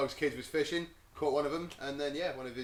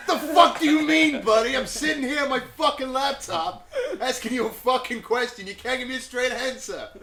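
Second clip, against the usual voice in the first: much higher, louder, slow furious New York shout; angry New Yorker; 9 semitones higher, 10 dB louder than usual. Retold or imitated. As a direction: blow up like a ranting New Yorker. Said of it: "He's, he's got a bit New Yorkie".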